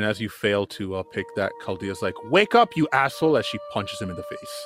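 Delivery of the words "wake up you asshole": a rude wake-up call; irritated yell. Acted. Chaldea yelling at Taz as she punches him, irritated. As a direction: snap it out high and sharp.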